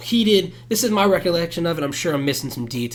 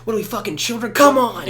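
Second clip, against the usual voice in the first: high but reined-in scolding, louder on come on; yell, but hold it down for the mic; held-back yell; 7 semitones higher than usual. Retold or imitated. Scolding friends while sparing the mic, a reined-in high yell. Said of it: "I'm trying not to be too loud for the mic".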